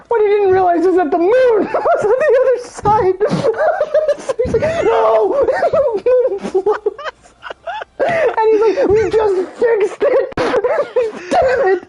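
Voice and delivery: high, wheezing through real laughter; laughing while talking. Real, not acted. Storyteller reveals Shadow hit the moon, laughing helplessly. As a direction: cry out the quotes while cracking up. Cracks up telling the moon mishap.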